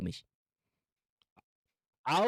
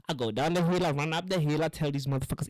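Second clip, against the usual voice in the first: usual speaking voice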